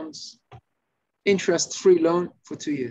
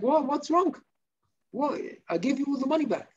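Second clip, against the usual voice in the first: high-pitched, puzzled protest; baffled borrower; 8 semitones higher than usual. Acted. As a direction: play a bewildered man asking what he did. Borrower baffled at being shouted at.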